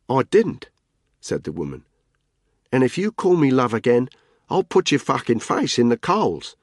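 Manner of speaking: flat Australian menace, promise it; Australian threat. Acted. Caitlin warns Tony off calling her love, Australian accent. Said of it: "Her accent was heavily Australian".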